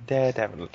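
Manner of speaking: "Daredevil" said in a put-on proper accent, clipped to "Dedevun"; said all proper. Shown as a demonstration. Co-host teasing the other about pronunciation, mock-proper "Daredevil". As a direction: show how to say "Daredevil" properly, put-on and affected. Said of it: "you gotta say it all proper".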